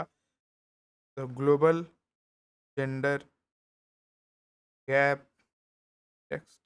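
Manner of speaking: each word drawn out for writing down; slow dictation. Shown as a demonstration. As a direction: spell out every word slowly, as for notes. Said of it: "dictating the title slowly". (लिखवाने के लिए बहुत धीमे, शब्द-शब्द खींचकर)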